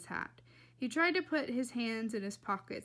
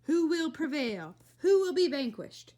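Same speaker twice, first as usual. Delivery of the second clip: loud, raised epic questioning; dramatic proclamation; 5 semitones higher, 8 dB louder than usual. A narrator's reading. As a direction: proclaim it like a battle showdown. Mock-epic question during the cat battle.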